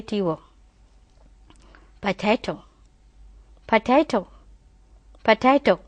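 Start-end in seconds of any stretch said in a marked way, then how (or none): 2.0-5.8 Potato with a plain British ending. Shown as a demonstration. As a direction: end Potato without rounding the lips. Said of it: "That is British English".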